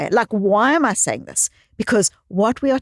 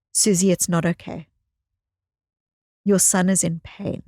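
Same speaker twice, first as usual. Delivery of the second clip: gentle; 2 semitones lower than usual. Retold or imitated. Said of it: "so kindly, so beautifully, so gently saying to me".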